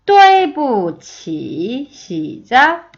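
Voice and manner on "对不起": 对不起 very slow, sharp fall then a dip and rise; tone demonstration. Shown as a demonstration. Teacher modelling the whole phrase, 对不起 slow and toned. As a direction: hit 对, drop, then stretch 起 through its dip. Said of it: "마지막".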